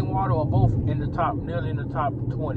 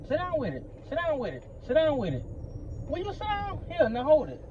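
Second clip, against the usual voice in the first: stern parent command; 9 semitones higher, 5 dB quieter than usual. Real, not acted. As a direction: repeat it sterner each time, almost yelling. Parent telling child sit down.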